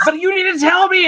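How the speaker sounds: high-pitched mock yelling; hammed-up screaming demand; Gary Oldman. Imitating a cop pressing the patient to talk, mock scream. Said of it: "he's screaming at Kevin Costner".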